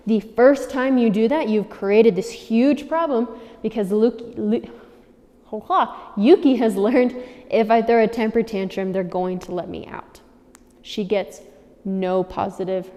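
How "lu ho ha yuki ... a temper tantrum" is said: ordinary delivery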